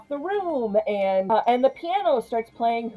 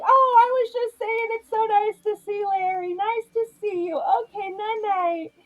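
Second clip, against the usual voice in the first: high, syrupy fake-innocent voice, much higher; sugary innocent; 12 semitones higher than usual. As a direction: play it falsely sweet, caught in the act. Sylvia covering, sugary exit.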